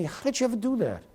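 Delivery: high-pitched retold astonishment; amazed. Radio boss reacting to the improvised alliterations, amazed. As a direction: imitate an impressed boss, voice lifted high.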